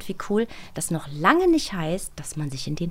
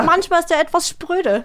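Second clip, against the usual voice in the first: higher and louder, playful; teasing; 9 semitones higher, 6 dB louder than usual. Real, not acted. Mock complaint about Alex being standoffish. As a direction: tease him with a grin.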